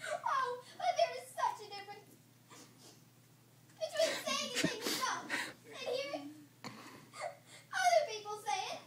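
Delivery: high, loud, slow words through tears; crying. Acted. Girl defending herself to Marilla, crying. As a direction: sob out the protest.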